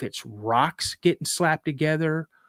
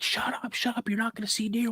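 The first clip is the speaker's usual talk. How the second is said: softer, higher urgent hush; retold scolding under the breath; mock hushed scolding; 8 semitones higher, 7 dB quieter than usual. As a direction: imitate a dad shushing his kid. Dad hushing the boy, retold.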